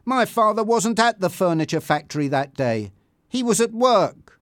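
indignant, pitch climbing; indignant defence. A narrator's reading. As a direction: defend your father firmly. Bianca defends her father.

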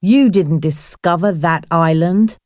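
plain reading voice

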